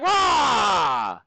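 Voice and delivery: shouted mock monster roar. Teacher telling the dream story, roaring as the monster. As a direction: bellow like a frustrated beast. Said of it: "He's like".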